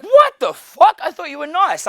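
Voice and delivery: imitating an offended woman; shocked girl. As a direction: squeak it like she can't believe it. Girl reacts to the neg.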